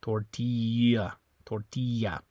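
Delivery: "Tortilla" slow, with a Y for the double L; Spanish tortilla. Shown as a demonstration. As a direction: say "Tortilla" tor-TEE-ya, slowly. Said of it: "It's a Y sound".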